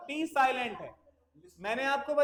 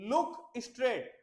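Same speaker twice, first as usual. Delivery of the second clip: slow dictation; slower than usual. Shown as a demonstration. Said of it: "driving करते वक्त क्या देखो बेटा?".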